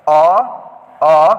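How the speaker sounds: अ called out loud and drawn; loud vowel demo. Shown as a demonstration. Teacher calls out अ for pupils to copy, loudly. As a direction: sound out अ clearly and loudly. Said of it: "तह पढ़ेंगे".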